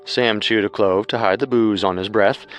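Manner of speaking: normal talking voice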